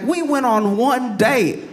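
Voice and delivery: high, drawn-out mock disbelief; incredulous protest. Woman to the man after one date, acted incredulous protest. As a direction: stretch it out in high-pitched exasperation.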